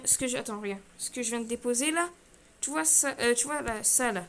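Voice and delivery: ordinary talk, nothing marked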